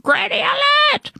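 very high rallying call; cried alarm. A narrator's reading. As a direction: sound the alarm in a piercingly high voice. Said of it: "cried Mavis Jones".

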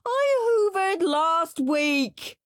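high, slow, loud wail; slow crying child's wail. Acted. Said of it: "wailed Henry".

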